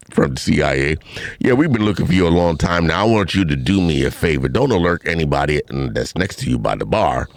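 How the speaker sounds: low, official phone voice; police constable. Retold or imitated. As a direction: pose as a constable on a call. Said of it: "This is Constable Brown".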